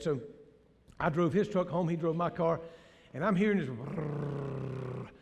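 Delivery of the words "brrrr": low, slow rumbling brrrr like a bad bearing; soft mechanical brrrr, imitating; imitated bearing noise. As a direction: mimic the grinding hum of a bearing. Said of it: "sound like a bearing noise".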